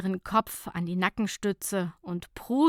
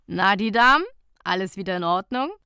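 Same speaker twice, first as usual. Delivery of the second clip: male lifeguard voice; 7 dB louder, faster than usual. A narrator's reading. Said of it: "fragte eine Männerstimme".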